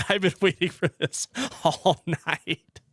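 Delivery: words breaking into laughter; amused, laughter bubbling up at the end; laughing. Real, not acted. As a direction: laugh through the line as the memory gets you. Laughing at own story.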